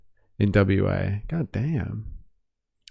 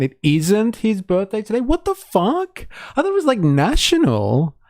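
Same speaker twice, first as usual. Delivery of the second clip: mock shock; 12 semitones higher than usual. Reading a chat message in mock shock.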